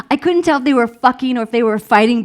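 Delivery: loud, high, rapid tumble of words; excited rush. Retold or imitated. Storyteller caught up in the coyotes' howling, excited rush.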